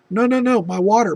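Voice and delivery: urgent protest. Retold or imitated. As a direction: stop him, you need the water. Wife urgently asking for water.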